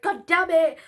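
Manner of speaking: retold cry of frustration, loud and high; exasperated mock shout; angry outburst. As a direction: re-enact a burst of anger, punched loud. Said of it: "because I was so angry".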